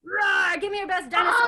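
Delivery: put-on high shouted call to the kids; loud call. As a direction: shout it out playfully. Teacher calls for dinosaur roars.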